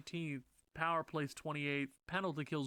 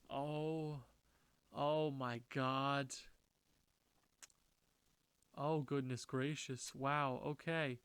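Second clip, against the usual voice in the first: slow dismay; much slower than usual. Real, not acted. Dismayed at bad stats.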